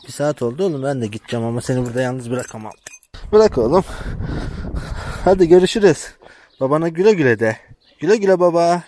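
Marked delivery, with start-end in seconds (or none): 3.3-6.1 raised, higher call across the yard; calling out loud. Real, not acted. Calling out to the lamb to let go and say goodbye, loud and high. 6.6-8.8 voicing the lamb. Voicing the lamb's goodbye.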